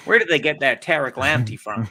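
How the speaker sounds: put-on hammy American accent for a fan. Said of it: "my American accent for Todd".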